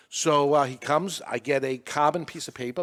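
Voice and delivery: usual speaking voice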